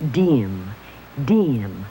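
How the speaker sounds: "Dim" stretched out slowly and low to sound it out. Acted. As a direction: pull the sounds of "Dim" apart, drawn low. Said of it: "I'd better sound it out".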